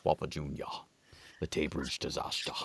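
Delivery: low, soft Scottish impression; slurred, hushed Scottish accent, deep in the chest; Sean Connery. Warming up a Scottish accent, low Scottish star impression. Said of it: "Sean Connery to get into my".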